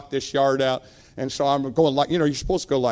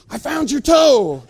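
high, loud, stretched-out good news; proud announcement; 10 semitones higher, 7 dB louder, slower than usual. Retold or imitated. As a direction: crow it like a triumphant boss.

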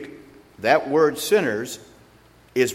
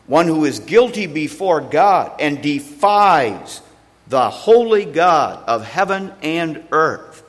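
louder and higher, preaching with rising force; building sermon; 4 semitones higher, 5 dB louder than usual. Acted. Preacher warns the church what a sinner is, swelling louder. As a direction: drive it home to the congregation.

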